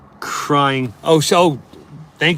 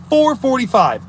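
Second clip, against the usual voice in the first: four forty-five hit hard and higher; emphatic repeat; 6 semitones higher than usual. Acted. Streamer stressing the scrapyard deadline, emphatic.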